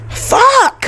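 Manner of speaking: very high, loud yelp of frustration; real angry high yell; frustrated shout. Real, not acted. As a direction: blurt it out in annoyance. Frustrated outburst.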